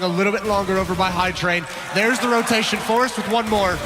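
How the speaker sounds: esports caster. Real, not acted. Caster building up the round.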